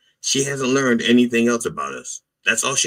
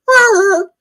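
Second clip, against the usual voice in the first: high, loud, strained cat meow; 8 dB louder than usual. Retold or imitated. Comic showing how his cat calls him, loud high meow. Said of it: "She says".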